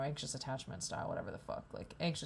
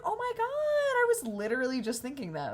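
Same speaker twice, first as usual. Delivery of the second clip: excited; 9 semitones higher, 6 dB louder than usual. Retold or imitated. Delighted at barista's surprise.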